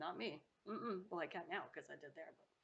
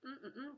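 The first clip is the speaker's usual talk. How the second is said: savoring hum; 8 semitones higher than usual. Real, not acted. Eating leftover rice on camera.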